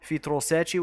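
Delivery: "Sete" as SEH-chee, Brazilian style; Brazilian pronunciation. Shown as a demonstration. Streamer corrects a viewer's name, "Sete" as SEH-chee. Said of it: "sorry, with the Brazilian accent".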